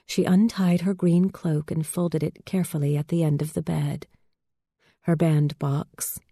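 plain reading voice

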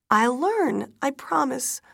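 high, loud, urgent pleading; desperate promise. A narrator's reading. Widow promising daughter she will learn, desperate. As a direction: promise as if close to breaking.

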